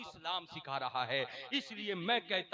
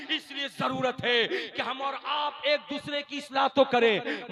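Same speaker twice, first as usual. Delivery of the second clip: loud, raised-pitch sermon delivery; full-force preaching; 5 semitones higher, 14 dB louder than usual. Real, not acted. Preacher urges families to learn from the Prophet's household, forceful preaching. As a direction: drive each exhortation out to the crowd.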